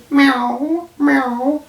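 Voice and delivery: high, tender meow meow like a cat; loving cat meows. Acted. Cat answering his owners with high loving meows. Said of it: "looked towards his owners lovingly and said".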